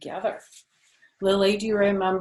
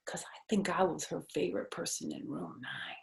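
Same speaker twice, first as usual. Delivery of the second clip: secret whisper; 6 dB quieter than usual. Acted. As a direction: share a secret under your breath. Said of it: "I made my voice kind of secret".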